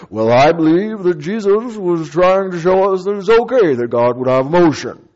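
pious pontificating colleague; mock a self-important pastor; lightly voiced preachy pastor; pompous pastor. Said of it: "there was a".

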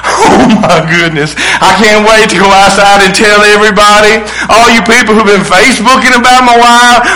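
triumphant preacher's re-enactment, rising in excitement; jubilant proclamation. Acted. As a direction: declare wonderful news to all the doubters. Acts out Zacharias's ideal joyful reaction.

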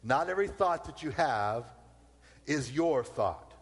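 slow, stress on your; slow it down and lean on your; said again slowly. Shown as a demonstration. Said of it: "I'm gonna say that again slowly".

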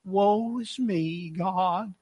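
slow, soft, low moan of woe; sarcastic self-pity. Acted. Jonah praying to God, sarcastic woe-is-me. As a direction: wallow in mock misery, drawn out. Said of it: "a sarcastic remark or the way he presents that".

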